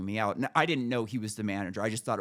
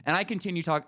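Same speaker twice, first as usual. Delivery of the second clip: ordinary delivery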